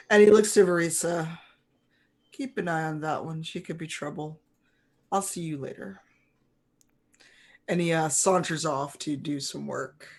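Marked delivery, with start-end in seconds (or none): 2.3-6.0 soft spymaster's parting word; hushed, understated caution; quiet warning. Acted. GM voices spy's quiet warning.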